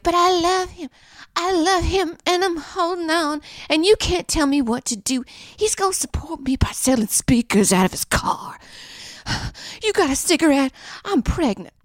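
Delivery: stubborn friend. Pretend. Friend Stephanie defending her boyfriend, high and whiny. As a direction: dig in like a clingy girlfriend.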